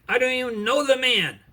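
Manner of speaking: fearful cursing denial. Acted. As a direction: curse it out in fear. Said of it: "began to curse and to swear and to deny".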